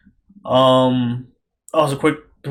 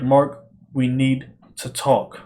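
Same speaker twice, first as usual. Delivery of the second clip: ominous father; 2 semitones lower, slower than usual. Retold or imitated. Father confronting son, retold scene.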